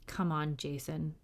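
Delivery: low, soft mutter. A narrator's reading. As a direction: mutter it under your breath. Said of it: "William muttered".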